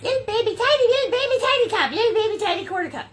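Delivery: put-on squeaky baby talk for a tiny cup; cutesy baby voice. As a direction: coo it like it's adorably tiny.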